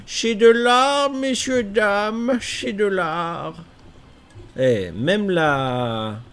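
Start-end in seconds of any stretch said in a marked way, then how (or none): 0.0-3.5 grand showman. Pretend. Admiring game art, showman style.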